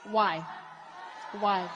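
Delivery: a loud, insistent why, twice; pressing shout. Real, not acted. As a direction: demand the reason, loudly. Interviewer pressing Trump for reasons.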